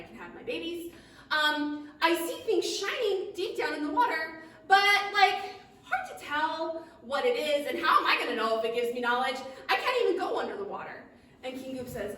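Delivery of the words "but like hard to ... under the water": plain reading voice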